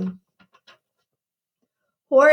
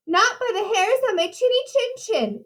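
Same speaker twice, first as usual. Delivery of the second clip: mock yell; 8 semitones higher than usual. A narrator's reading. Horace refuses the wolf at his door, high mock yell. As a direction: defy the wolf in a high little voice. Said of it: "yelled Horace".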